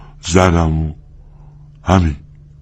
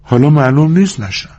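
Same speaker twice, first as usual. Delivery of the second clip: wistful, higher-pitched woman's voice; 9 semitones higher than usual. A narrator's reading. Woman clinging to her dream, wistful. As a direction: say it with a touch of longing. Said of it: "با لحنی حسرتبار ادامه داد".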